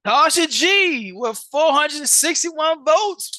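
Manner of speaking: loud, high, slow big reveal; excited announcing. Real, not acted. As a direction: call the name out like a host. Announcing second place winner.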